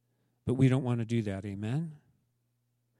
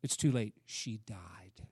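grim messenger; 6 semitones lower, 7 dB quieter than usual. Retold or imitated. Messenger with death news.

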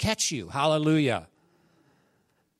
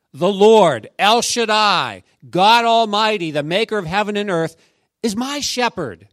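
proclaiming; 3 semitones higher, 7 dB louder than usual. Real, not acted. Preacher proclaiming God's names.

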